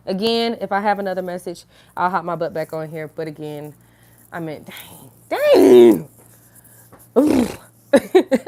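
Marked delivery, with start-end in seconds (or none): 5.3-8.4 high surprised exclaim; dang. Real, not acted. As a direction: blurt it out, caught off guard. Laughing at herself.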